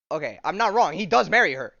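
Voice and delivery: loud incredulous confirmation; exasperated exclaim. Real, not acted. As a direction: announce it, still stunned. Confirming the shocking twist.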